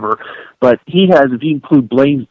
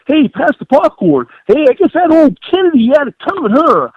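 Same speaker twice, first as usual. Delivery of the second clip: mock naive publicist; 13 semitones higher than usual. Host mocks a naive publicist with a high dopey voice. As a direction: play a clueless popcorn-munching publicist. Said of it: "a naive publicist would go".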